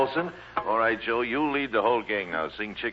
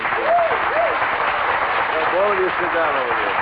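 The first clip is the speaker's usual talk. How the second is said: calling out; 5 semitones higher, 6 dB louder, much slower than usual. Real, not acted. Host sending the boys back to their seats, loud call. Host directing kids off stage.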